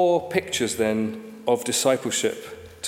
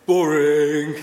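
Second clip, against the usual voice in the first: put-on louder flat teenage "Boring."; sulky teenager; 7 dB louder than usual. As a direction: dismiss the dad with "Boring.". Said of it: "And he would say".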